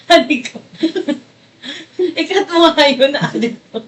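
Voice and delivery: words bubbling over with giggles; laughing. Real, not acted. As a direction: talk through the laughter. Giggling while teasing her partner.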